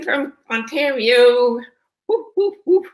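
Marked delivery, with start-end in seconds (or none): 2.1-2.9 high, playful whoop whoop call; excited cheer. Real, not acted. Host welcoming live viewers with a high excited whoop.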